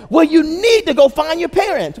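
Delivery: high, loud mock-dad holler; imitated dad yelling. Dad telling his kids what they need to do, yelling. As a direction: yell the order as an insistent dad. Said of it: "he starts yelling at us".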